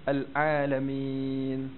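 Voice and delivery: very slow "Al-Alamin" with a moderate Ain; a measured, balanced Ain in "Al-Alamin"; middle Ain. Shown as a demonstration. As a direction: say "Al-Alamin" with a balanced Ain, neither pressed nor lazy. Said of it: "Di pertengahan".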